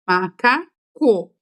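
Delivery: "Macaco" slowly split, ma, ca, co; three drawn-out beats on "Macaco"; syllable by syllable. Shown as a demonstration. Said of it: "quando começa a contar sílabas".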